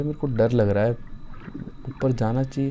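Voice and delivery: ordinary talk, nothing marked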